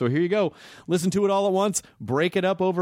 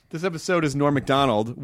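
ordinary talk

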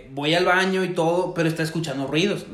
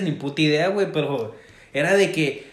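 usual speaking voice

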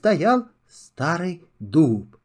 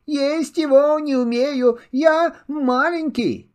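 tearful kitten; 8 semitones higher than usual. Acted. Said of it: "и заплакал".

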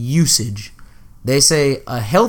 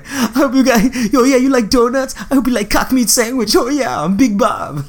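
much higher, slowed, grinning mock voice; Big Bob impression; 10 semitones higher than usual. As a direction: ham up the creepy cook offering sandwiches. Movie scene retold, Big Bob.

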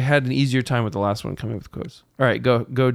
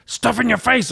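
Gimli; 7 semitones higher than usual. Retold or imitated. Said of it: "making fun of him".

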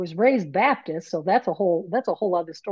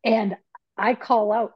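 normal talking voice

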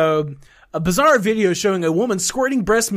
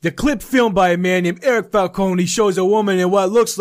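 Brooklyn accent; 3 dB louder than usual. Shown as a demonstration. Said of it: "You read it in a Brooklyn accent".

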